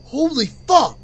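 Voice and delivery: high, loud burst of shock; shocked exclamation. Real, not acted. Streamer hearing gunshots in a video, shocked exclamation. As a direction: exclaim in real alarm.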